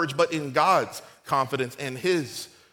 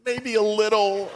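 tearful admission; 4 semitones higher, slower than usual. Retold or imitated. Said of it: "her eyes were welled up with tears".

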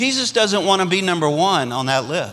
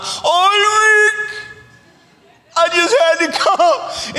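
much higher, laughing grandma voice, slowed; beaming old grandma delighted to arrive; joyful grandma; 11 semitones higher, much slower than usual. Retold or imitated. Frail grandmother arrives at first service, laughing with joy. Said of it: "she had a big smile".

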